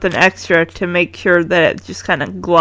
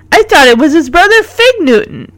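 joking pupil; 7 semitones higher than usual. A narrator's reading. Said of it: "Punky says, which gets a laugh".

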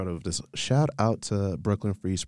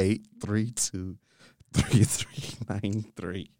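digits spelled out slowly; slow careful dictation; much slower than usual. Real, not acted. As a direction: read each digit slowly and clearly. Reading number to dial.

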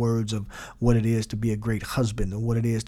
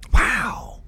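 awe; 11 semitones higher, 5 dB louder than usual. Shown as a demonstration. Awe during meditation demo.

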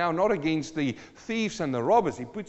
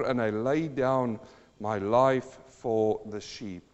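preaching scripture; 7 dB louder than usual. Acted. Reciting scripture, slowing at end.

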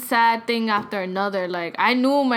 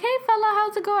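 high-pitched imitation of an aunt answering the phone; imitated aunt, pitched way up; aunt's cheery greeting; 11 semitones higher, faster than usual. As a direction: put on a high, casual hello. Aunt greets in retold call.

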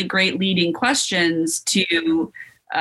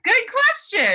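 mock-bright sarcastic praise, pitched much higher. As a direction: squeak it out as a jokey dig. Sarcastic reply to his question.

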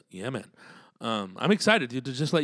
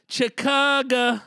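high, slow, stretched "Chicago" like a crowd hype; drawn-out call; 13 semitones higher, 7 dB louder, much slower than usual. Acted. Hyping a fan from Chicago, high stretched call "Chicago".

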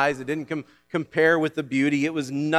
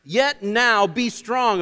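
proclaimed; 7 semitones higher, 4 dB louder, much slower than usual. Acted. Preacher proclaiming scripture loudly.

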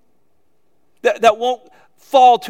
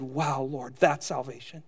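softer, awed aside to God; hushed awe; 7 semitones lower, 10 dB quieter than usual. Retold or imitated. Preacher recalls marveling to God while reading, softer and awed. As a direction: breathe it in quiet wonder. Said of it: "and I said".

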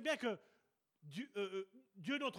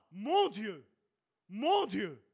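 louder desperate "Mon Dieu, mon Dieu" called out; loud distant cry to God; 9 dB louder, much slower than usual. Retold or imitated. Believer calling "Mon Dieu" from afar, loud. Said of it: "tu ne diras pas".

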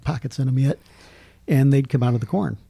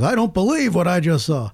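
Jack Buck; 5 semitones higher than usual. Retold or imitated. Announcer calling Kirk Gibson's home run, higher incredulous call. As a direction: imitate a play-by-play caller in disbelief. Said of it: "Jack Buck says".

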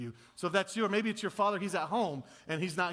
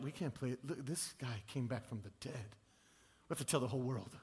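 acted hushed awe; 4 semitones lower, 7 dB quieter than usual. Disciples after seeing him risen.

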